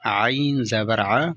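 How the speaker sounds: ع drawn out slowly with a rising tone; vowel sound demo. Shown as a demonstration. Teacher slowly models the ع sound for students. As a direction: slowly sound out عین زبر ع, stretching the ع. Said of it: "یہ دیکھو".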